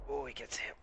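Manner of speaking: normal talking voice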